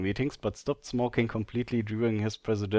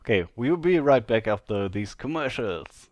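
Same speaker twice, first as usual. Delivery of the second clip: game show host; 6 dB louder than usual. Acted. Quiz host announces commercial break.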